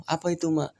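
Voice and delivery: put-on a child eagerly asking his mother; curious young son. Son asks mother about inheritance. (suara anak yang penasaran)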